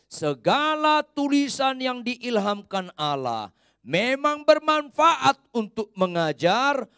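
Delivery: high and loud, slowed, leading the reading; loud scripture reading. Acted. Pastor leading the congregation through the verse, loud scripture reading. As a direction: call the verse out so all read along.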